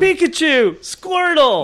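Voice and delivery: put-on high, loud kid-like cheers; excited little kids. Kids spotting favourite characters, high excited shouts. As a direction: call out each name in a high voice. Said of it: "They get very excited about that".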